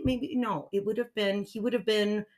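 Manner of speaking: ordinary delivery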